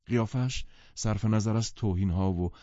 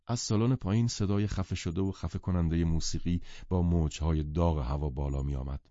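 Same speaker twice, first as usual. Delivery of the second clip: ordinary delivery, nothing marked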